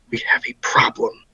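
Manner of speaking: hushed, secretive whisper into an ear. Acted. Hugging a friend and warning him in his ear, whispering. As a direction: whisper it softly, for one listener only. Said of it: "like, whisper into his ear".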